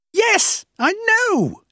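very high-pitched, exclaimed retort; exasperated admission. A narrator's reading. Boss owning up to cheating customers, exasperated. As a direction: throw it back at her shamelessly.

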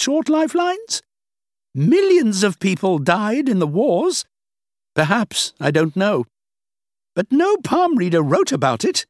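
a hint of a dismissive older woman; lightly played older woman, amused. The woman presses her case.

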